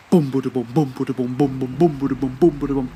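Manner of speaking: rhythmic vocal drum rolls; imitated drumbeat. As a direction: chant the beat of ceremonial drums. Narrator imitates the ceremony drums.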